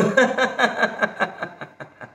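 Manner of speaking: staccato laugh tapering off; laughter exercise. Shown as a demonstration. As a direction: laugh on one breath until it fades. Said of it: "आणि फेका". (विरत जाणारं हास्य)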